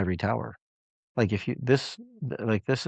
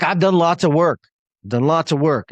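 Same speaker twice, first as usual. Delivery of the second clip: higher, louder defensive repetition, imitating her; defensive insisting; 6 semitones higher, 7 dB louder than usual. Coach imitating a defensive client on a call, higher and louder. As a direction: push back hard, mimicking a standoffish client. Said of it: "she's standoffish, she's defensive".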